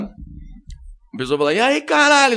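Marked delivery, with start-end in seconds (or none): 1.5-2.3 much higher, loud mock bro yell; rowdy fan greeting. Excited fan greets comedian, hammed-up bro yell. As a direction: greet him like an old drinking buddy. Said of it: "Você fala".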